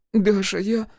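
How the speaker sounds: put-on trembling girl naming herself; a touch of sob in a high voice; sobbing answer. A narrator's reading. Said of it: "всхлипнула девка".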